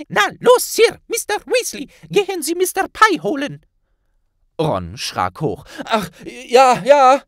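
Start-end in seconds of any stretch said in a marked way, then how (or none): 0.1-3.7 very high, loud squeak; squeaking elf. Acted. Elf Flabbles squeaks at Ron to fetch the healer. 5.8-7.2 jolted, loud and higher, rushed; hasty startled. Acted. Ron startled by the elf, hastily agreeing. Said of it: "erwiderte er hastig".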